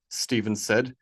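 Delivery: plain reading voice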